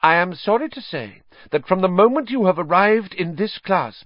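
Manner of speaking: crush her with haughty disdain; cold anger. Acted. Trelawney dismissing Hermione, high icy anger. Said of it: "unmistakable anger".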